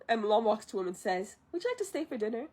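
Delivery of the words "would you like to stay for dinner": softer, higher polite question; polite invitation. Retold or imitated. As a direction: ask it lightly, voice lifted and soft. Mulan's polite invitation acted.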